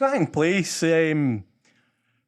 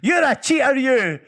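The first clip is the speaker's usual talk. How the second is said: put-on high, louder little girl's accusing shout; child shouting; 9 semitones higher, 4 dB louder than usual. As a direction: point and yell it like a brave wee girl. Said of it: "stood up and pointed and shouted".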